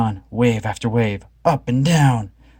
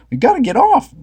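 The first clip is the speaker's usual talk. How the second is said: high, louder panic; panicked Shaggy; 6 dB louder than usual. A narrator's reading. Shaggy begging to get off, high and louder. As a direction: push it out in fear. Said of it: "Shaggy screamed".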